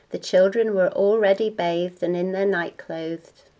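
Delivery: usual speaking voice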